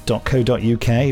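usual speaking voice

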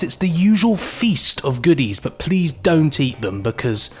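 ordinary talk

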